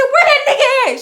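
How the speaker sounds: loud, high confrontational holler; angry mama yell. Retold or imitated. Mama hunting for the man, yelling angrily. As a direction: imitate a mother yelling, ready to fight. Mother arriving ready to fight.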